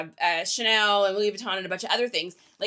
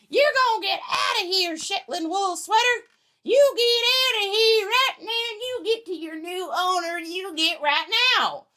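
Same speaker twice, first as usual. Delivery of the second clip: put-on Southern accent; 7 semitones higher than usual. Seller sends the sweater to its new owner, hammed-up Southern accent. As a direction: order it out in an exaggerated Southern accent. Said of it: "Was that a Southern accent?".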